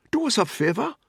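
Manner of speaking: high, loud, insistent appeal; desperate pleading. A narrator's reading. Man pressing controller to allow the toilet, desperate pleading. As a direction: press him urgently for a favour.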